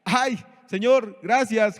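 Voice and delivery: slow, exaggerated happy sigh of thanks; mock cheerful thanks. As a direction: parody a carefree believer. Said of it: "estaba contento, estaba riéndose?".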